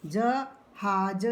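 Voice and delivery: ज हाज, the syllables slow with हाज drawn out; slow blending. Shown as a demonstration. Teacher sounding out जहाज slowly for pupils. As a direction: split ज हाज slowly before the full word. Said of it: "आ की मात्रा".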